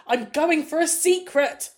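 high, slow, loud announcement imitating a child. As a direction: belt it out like a kid announcing it. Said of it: "And very loudly go".

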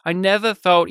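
ordinary talk

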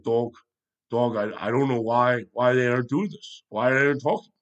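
a lightly voiced caller, barely off the speaker's own voice; understated mock caller pleading to Dog; Fan Sessa impression. Podcaster imitating a caller to Mad Dog, lightly voiced and plain-ish. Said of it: "I probably should have done Fan Sessa".

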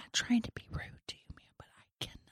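soft, polite whispered complaint. Acted. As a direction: whisper an apologetic objection. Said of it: "We are all whispering".

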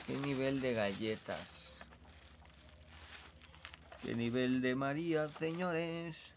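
put-on light Castilian Spanish accent, señores with a th. Showing his cookies to the viewers, in a light Spanish accent. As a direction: show off the cookies like a man from Spain. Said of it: "el acento me está saliendo español".

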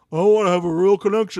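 a lightly put-on sincere-guy voice, quick; a hint of a fake heartfelt dude; mocking his switched voice. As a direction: mimic him faking earnestness, rattled off fast. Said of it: "he actually, like, switched his voice".

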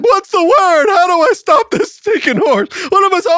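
high, loud comic panic; hammed-up panicked shouting; freaking out. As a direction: yell it frantically, way up high. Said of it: "he's freaking out".

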